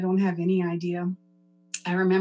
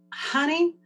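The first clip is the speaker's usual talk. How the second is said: lightly imitating a Texas drawl, higher and slow; a hint of an old Texas lady, high and drawn out; mild Texas accent impression, higher pitch, slow; 4 semitones higher, much slower than usual. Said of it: "a thick Texas accent".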